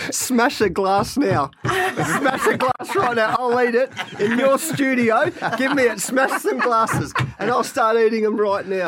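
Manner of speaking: laughing, pitched high and slow; cracking up at the absurd dare. Real, not acted. To the host, laughing while offering to eat glass. Joking about eating glass.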